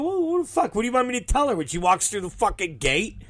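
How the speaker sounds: high-starting, rapid flustered protest; defensive protest. Retold or imitated. Promoter answering everyone staring at him, defensive protest. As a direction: rattle it off as an exasperated self-defence.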